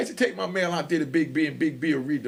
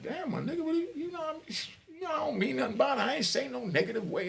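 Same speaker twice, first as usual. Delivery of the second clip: surprised excuse; 11 semitones higher, 5 dB quieter than usual. Retold or imitated. Explaining himself, surprised.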